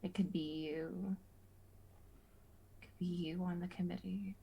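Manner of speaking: breathy coaxing whisper; tempting whisper. Acted. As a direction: coax them softly. Tempting voice continues.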